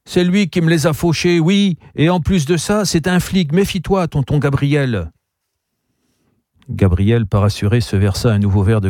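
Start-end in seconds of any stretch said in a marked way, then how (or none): 0.1-5.1 put-on high, indignant little girl's voice; girl accusing. Girl warns her uncle the visitor is a cop. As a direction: tattle on him to your uncle.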